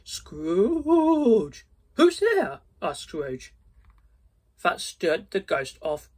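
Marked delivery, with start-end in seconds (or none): 0.0-1.6 high, loud moaning ghost; Marley's ghost moaning at Scrooge; ghostly moan. Acted. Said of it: "Then the voice moaned". 1.9-2.6 much higher, louder frightened cry; startled Scrooge calling out into the dark. Acted.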